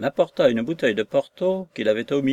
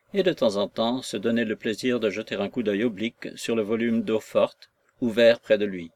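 usual speaking voice

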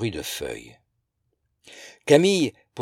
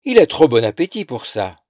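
mocking laughter; 3 semitones higher, 9 dB louder than usual. A narrator's reading. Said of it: "un rire clair et railleur".

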